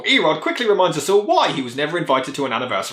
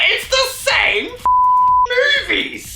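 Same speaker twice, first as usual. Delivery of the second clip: mock squeak. Mocking the repeated jokes.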